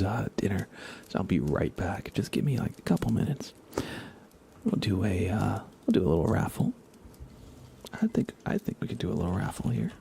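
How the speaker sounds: usual speaking voice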